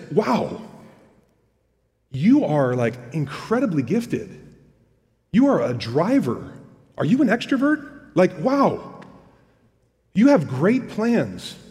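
mock amazement with big pitch swings and pauses; snarky. James to self-made planners with great plans, snarky. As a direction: gush sarcastically, as if deeply impressed. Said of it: "he's kinda being really snarky".

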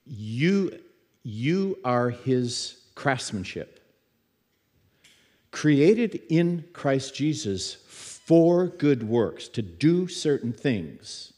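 slow, measured reading of a Bible verse; scripture reading. Acted. Preacher reading Ephesians to the congregation, slow and measured. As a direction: lay each phrase down slowly, like reading from the Bible.

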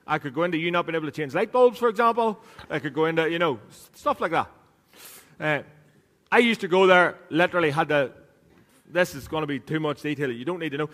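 usual speaking voice

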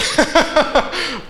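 short high burst of laughter; a quick amused laugh at the joke. Real, not acted. Preacher laughing at his own whiny Jonah line.

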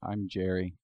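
softly spoken, timid dad; Jerry impression. Introducing a Jerry impression to the group, softly and meekly. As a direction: introduce yourself meekly, underplayed. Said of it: "This is my Jerry impression".

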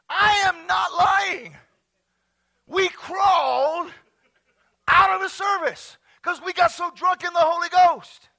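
loud, high, stretched-out declaration; preacher hammering home a wild story; emphatic insistence. Real, not acted. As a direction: shout it slowly to be believed.

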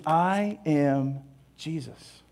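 very slow, soft and solemn; voice of Jesus. Acted. Jesus answering Saul, slow and hushed. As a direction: let each word land slowly and quietly. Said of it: "the Lord responds, says".

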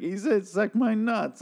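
high, slow mocking repeat; villain mocking the dead man's last words; mocking Negan. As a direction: mimic him back with a sneer. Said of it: "and then Negan's like".

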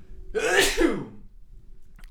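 a real sudden sneeze; sneezing. Real, not acted. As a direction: let out one sharp sneeze. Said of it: "I sneezed it out".

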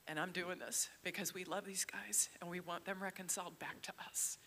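soft unvoiced whisper. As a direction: whisper it tenderly. Jesus' words, whispered.

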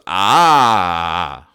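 loud frazzled mom's scream; loud exasperated shriek. Retold or imitated. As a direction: let out a fed-up mother's scream. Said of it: "she screams".

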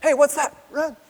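high, hurried mock-panic voice; hammed-up high, frantic urging; urgent escape. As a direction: squeak out a comic getaway order. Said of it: "Grab her by the arm and be like".

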